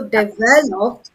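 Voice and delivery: slow develop with VEL stretched and punched; second-syllable stress. Shown as a demonstration. Teacher showing syllables, slow develop stressed on VEL. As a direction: lean long on VEL in develop. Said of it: "putting the stress on the second syllable".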